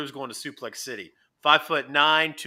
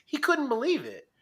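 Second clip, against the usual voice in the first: higher pitch; 12 semitones higher than usual. Real, not acted. Repeats line with raised pitch.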